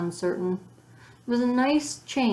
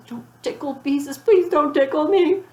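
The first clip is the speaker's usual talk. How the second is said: put-on high, louder begging child; pleading; 8 semitones higher, 6 dB louder than usual. A narrator's reading. Ramona begging her sister not to tickle her, pleading. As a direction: plead like a panicked little girl.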